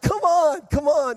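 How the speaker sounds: begging his tired family to get up; eager pleading. Retold or imitated. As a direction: egg the family on, all wound up. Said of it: "I'm just so excited".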